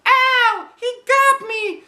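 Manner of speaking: very high scream, slow at the end; shrieking girl hit by a ball. A narrator's reading. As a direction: yelp from being hit. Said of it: "screamed Emily".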